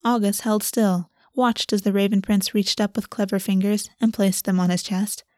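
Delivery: ordinary delivery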